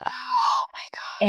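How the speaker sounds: tearful, breathy whisper of shock; barely gets it out through tears; a stunned, crying hush; choked-up whisper. Real, not acted. Hearing of lonely miscarriage.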